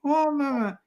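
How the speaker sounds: high-pitched mock English accent; proper English accent. Son mimicking his mother's speech, high proper English accent. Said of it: "talks with the proper English accent".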